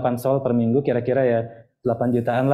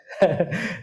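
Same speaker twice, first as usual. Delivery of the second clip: embarrassed laugh; 5 semitones higher, 3 dB quieter than usual. Real, not acted. Laughs after a wrong click.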